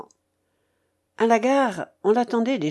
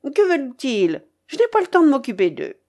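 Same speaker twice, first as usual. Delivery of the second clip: high, quick, dismissive complaint; impatient cry; 5 semitones higher, 4 dB louder than usual. A narrator's reading. Babouchka dismisses her waiting relatives, impatient. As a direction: brush them off in a hurry. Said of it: "criait la babouchka".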